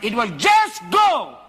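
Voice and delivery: loud, high, laughing fire-and-brimstone preaching; preaching at full force. Acted. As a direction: shout it out to the congregation, laughing with zeal.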